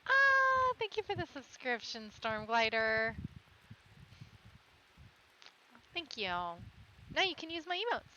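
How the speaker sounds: high, bright-eyed thank you to a fan; chirpy stream shout-out; excited thanks. Real, not acted. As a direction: gush over the new subscriber. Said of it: "thanking subscriber".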